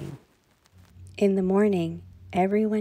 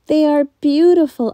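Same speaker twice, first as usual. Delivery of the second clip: high, loud delight; a girl thrilled with bangles; excited daughter; 9 semitones higher, 9 dB louder than usual. A narrator's reading.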